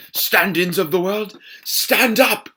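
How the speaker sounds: rally the downtrodden, climaxing in a shout; impassioned British stage shout, rising to the last command; rousing rant. Acted. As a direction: build to a loud, high British rallying cry. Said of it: "goes on a rant about how the understudies of the world should rise up".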